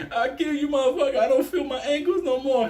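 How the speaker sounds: very high hammed-up meltdown; high-pitched mock-hysterical rage; cracked, crazed threat. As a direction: wail it out like someone snapping. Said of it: "He cracked".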